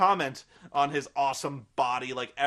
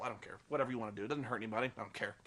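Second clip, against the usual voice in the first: much softer, lower throwaway; dismissive mutter; 6 semitones lower, 9 dB quieter, much faster than usual. Real, not acted. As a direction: shrug it off under your breath. Dismissive aside to himself.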